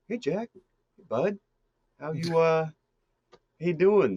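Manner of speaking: high, hesitant, friendly Midwesterner; awkwardly polite villain small talk; normal Midwest guy. Acted. Mastermind greeting his tied-up captive, awkward high Midwest-guy voice. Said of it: "just a normal guy from the Midwest".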